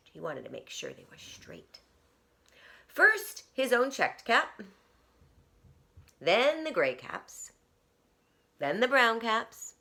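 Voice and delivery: plain reading voice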